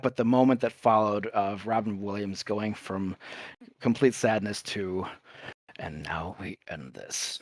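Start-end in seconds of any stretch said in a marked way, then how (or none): 5.8-7.4 low, slow imitated whisper; grave whisper. Quoting the film after Rufio's death, grave whisper. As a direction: whisper it slowly, like a final vow. Acting out the film's grim turn.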